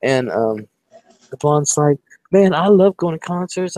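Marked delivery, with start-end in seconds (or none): 2.3-3.8 put-on higher-pitched eager party girl; enthusiastic gushing. Retold blonde telling of her love of concerts, enthusiastic. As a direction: imitate an eager concert fan.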